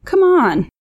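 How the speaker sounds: wheedle, pitched well up; coaxing plea. A narrator's reading. Man pressing girl for a date.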